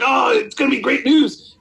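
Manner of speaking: high excited shout; mock whoop of joy. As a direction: shout out the good news in glee.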